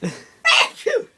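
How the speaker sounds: loud high sneeze; a big real sneeze; sneezing. Real, not acted. Sneezing fit, laugh before.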